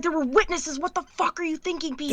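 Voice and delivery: high, incredulous tirade; exasperated scolding. Acted. Sister berating her brother in the interrogation room, high exasperated scolding. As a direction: tear into him for being reckless.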